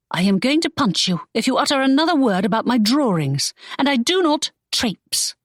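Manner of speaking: mock-fierce young lady; Imogen annoyed. Imogen warning Draco about her drawings, indignant.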